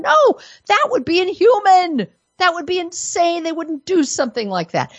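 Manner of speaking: high-pitched mock disbelief; dripping sarcasm in a high voice; sarcastic. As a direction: feign outrage at the very idea, pitched well up. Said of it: "I must apologize for my sarcasm".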